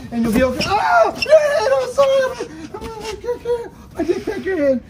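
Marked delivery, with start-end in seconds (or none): 0.7-2.5 high, hammed-up crying apology; mock wail. Dad cracked stone child's head. 2.7-4.8 laughing through words. Real, not acted. Dad laughing mid-pretend grief.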